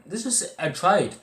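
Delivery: high and loud, slowed down on I tried; pleading emphasis; emphatic insistence. Real, not acted. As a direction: insist that you really tried. Insisting he really did try.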